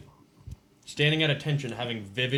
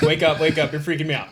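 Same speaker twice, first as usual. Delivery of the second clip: raised voice, urgently rousing someone; urgent wake-up call; 3 semitones higher, 8 dB louder than usual. Retold or imitated. Husband waking his sleep-talking wife, urgent raised voice. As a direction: act out a loud, freaked-out plea.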